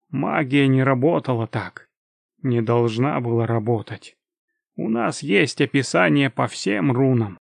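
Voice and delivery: usual speaking voice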